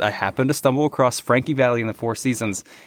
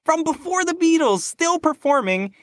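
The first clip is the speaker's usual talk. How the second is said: even higher, emphatic; excited; 12 semitones higher than usual. Real, not acted. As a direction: stress the [name] with awe. Repeats with more emphasis.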